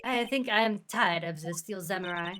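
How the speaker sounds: put-on world-weary line in a French accent. Vasquez tired of the Steel Samurai, French accent. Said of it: "My French accent is amazing".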